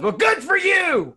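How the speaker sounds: high, loud, slow and spiteful; loud hateful yell. Retold or imitated. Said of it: "real loud and hateful".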